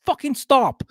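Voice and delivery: high-pitched, emphatic mock order; exasperated command. Host telling people to stop littering space, high exasperated command.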